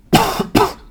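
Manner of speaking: sharp real cough; a loud, high cough; coughing fit. Real, not acted. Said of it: "sorry, ich muss kurz husten".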